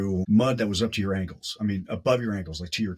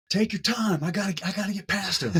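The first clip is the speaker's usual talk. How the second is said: imitating Neil, much higher, hurried and cocky; 10 semitones higher than usual. Neil leaving Corey behind in the dark race, high cocky imitation. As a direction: brush him off as you race away.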